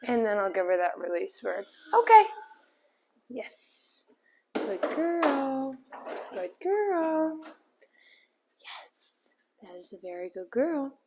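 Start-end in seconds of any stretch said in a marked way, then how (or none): 1.9-2.2 sudden high, loud okay; bright release. Real, not acted. Trainer frees the puppy from her place with a high, loud okay. 3.3-11.0 quiet, gentle praise; soft praise. Real, not acted. Trainer softly praises Daisy staying on her bed, quiet.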